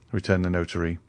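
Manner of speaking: plain reading voice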